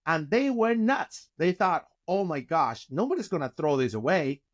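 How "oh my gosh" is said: ordinary talk, nothing marked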